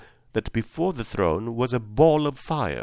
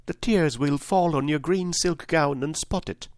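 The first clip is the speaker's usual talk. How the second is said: put-on high girlish voice; Green Girl; 8 semitones higher than usual. A narrator's reading. Green Girl warning Dorothy about her silk gown, high-pitched. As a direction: fuss in a high, cautioning girl's voice. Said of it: "cried the Green Girl".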